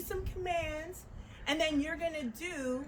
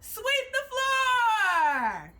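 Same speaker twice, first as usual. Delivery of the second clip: long, drawn-out shout, higher and louder; called-out command; 6 semitones higher, 4 dB louder, much slower than usual. Shown as a demonstration. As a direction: belt the drill call across the room. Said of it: "Let's try it".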